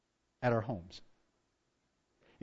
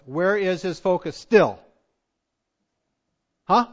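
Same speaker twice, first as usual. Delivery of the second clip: ordinary delivery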